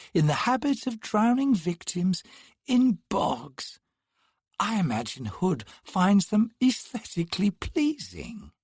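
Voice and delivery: sneering brother looking down at Perrin; mocking twin. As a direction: toy with him mockingly. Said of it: "The man's smile turned mocking".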